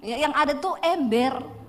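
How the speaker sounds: ordinary delivery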